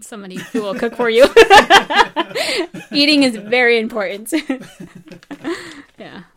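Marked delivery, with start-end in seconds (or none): 1.3-2.9 big bright burst of laughter; loud laugh. Real, not acted. Laugh after joke. 4.4-5.9 laugh. Real, not acted. Laugh after joke.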